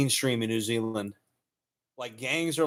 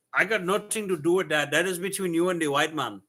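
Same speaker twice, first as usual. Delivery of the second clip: higher-pitched mock Indian accent; 4 semitones higher than usual. Streamer as an Indian man declining involvement, higher mock Indian accent. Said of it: "I like doing the Indian voice".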